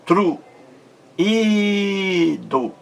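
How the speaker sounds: Tru alone, then Ido with a long high í; separated syllables. Shown as a demonstration. Teacher showing vogais em sílabas diferentes, split and stretched Tru, Ido. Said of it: "em sílabas diferentes".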